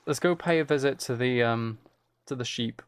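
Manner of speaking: ordinary delivery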